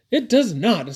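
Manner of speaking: high, loud contradiction; Callie. A narrator's reading. Callie arguing with Caleb, indignant denial. Children quarrelling over the whinny.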